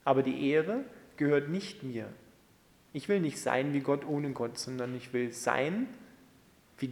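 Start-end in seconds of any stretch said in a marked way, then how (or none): none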